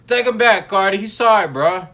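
high, loud pretend begging; loud mock plea. Pretending to plead with [name], loud and high.

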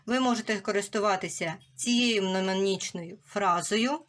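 ordinary delivery

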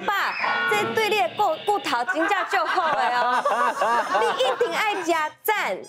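high, salesy pitch voice; shopping channel host. Acted. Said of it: "你是在当购物台吗". (购物台主持腔)

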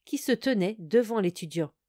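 plain reading voice

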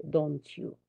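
ordinary delivery

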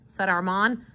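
usual speaking voice

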